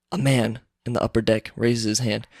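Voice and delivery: ordinary talk, nothing marked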